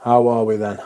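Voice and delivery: talking while chewing gum. Real, not acted. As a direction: speak around a piece of gum. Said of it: "I've got some chewing gum in".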